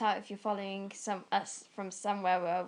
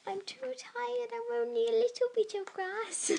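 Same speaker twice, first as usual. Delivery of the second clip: voicing the grass; 14 semitones higher than usual. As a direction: speak as a weary little patch of grass. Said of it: "so it's just like".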